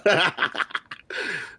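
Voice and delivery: high, drawn-out burst of laughter; laughing. Real, not acted. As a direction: laugh at your own joke. Laughs after joking about the accent.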